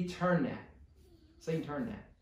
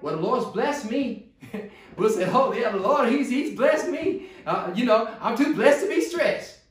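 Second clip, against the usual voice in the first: mocking smug believer; 6 semitones higher, 8 dB louder than usual.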